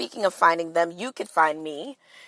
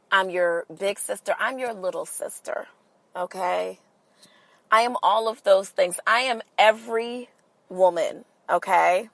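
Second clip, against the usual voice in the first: normal talking voice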